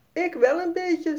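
put-on tiny hesitant child's voice; small voice. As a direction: shyly admit being a bit scared. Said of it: "met een klein stemmetje".

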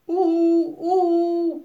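high, loud hooting owl call. A narrator's reading. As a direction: hoot like an owl, high and ringing. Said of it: "klinkt er uit een van de kooien". (hoge uilenroep oehoe)

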